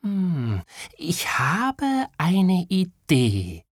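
slow, high, hushed dawning idea; thinking aloud. A narrator's reading. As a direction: draw it out as the idea forms. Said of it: "Kaya dachte einen Moment nach". (nachdenklich, langsam und gehaucht)

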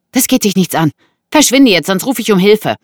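high, loud threat; indignant woman. Acted. Woman orders intruder out of her room, indignant.

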